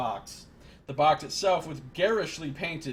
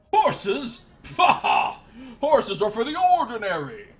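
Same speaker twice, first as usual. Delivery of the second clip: much higher and louder pompous prince; scoffing prince; 4 semitones higher, 7 dB louder than usual. Acted. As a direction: sneer at the very idea of horses.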